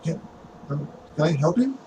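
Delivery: soft, halting question through a barely open door; timid young doorkeeper; hesitant boy. Acted. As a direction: stammer politely, unsure of the visitor. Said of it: "He just goes like".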